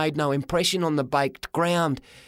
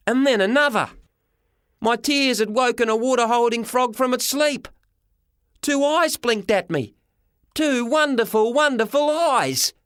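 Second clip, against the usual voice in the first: excited; 7 semitones higher than usual. A narrator's reading. Boy narrates rising excitement.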